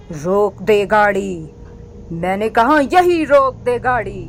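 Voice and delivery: slow, eerie commanding witch; witch's true voice. Acted. Witch revealing herself to the boy in the car, eerie command. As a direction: drop the disguise and order him to stop. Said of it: "ये आपकी आवाज को क्या हुआ?".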